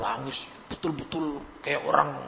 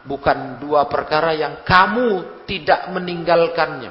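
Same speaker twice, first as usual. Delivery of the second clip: ordinary talk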